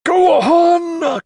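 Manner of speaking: very high, loud screamed order; desperate shriek urging the horde on. A narrator's reading. Carstairs crumbles as the horde retreats, shrieking. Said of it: "Carstairs shrieked".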